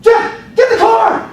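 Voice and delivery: high, loud, slow yell; frantic yell. Retold or imitated. Driver yells to his friend to get in the car, slow loud yell. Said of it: "Homeboy keeps yelling".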